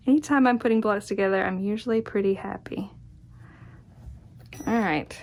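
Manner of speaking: ordinary talk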